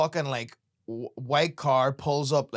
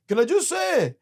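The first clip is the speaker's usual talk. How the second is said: heckler voice; 11 semitones higher, 4 dB louder than usual. Retold or imitated. Heckler quoted interrupting set.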